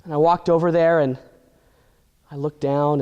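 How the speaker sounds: normal talking voice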